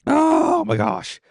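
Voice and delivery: slow, high, loud wail of relief; high drawn-out cry. Real, not acted. Finally winning a game, a high slow cry. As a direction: let it out slowly and high. After winning while burning.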